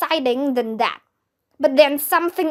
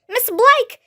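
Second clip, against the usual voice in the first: higher, louder call for the teacher; urgent shout for help; 10 semitones higher, 4 dB louder than usual. A narrator's reading. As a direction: call out to her across the camp. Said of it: "shouted Andrea".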